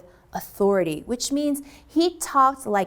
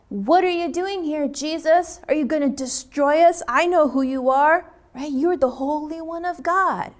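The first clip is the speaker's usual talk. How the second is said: possessed man; 6 semitones higher, 3 dB louder than usual. Acted. Possessed man confronts Jesus.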